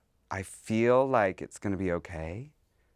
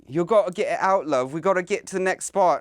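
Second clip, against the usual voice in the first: higher and louder, a hammed-up British accent; bad British accent; 10 semitones higher, 7 dB louder than usual. Mimicking a drunk UK girl to her friend in a bad British accent. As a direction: do a terrible British girl's accent. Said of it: "That's a terrible accent".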